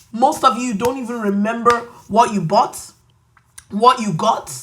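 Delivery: normal talking voice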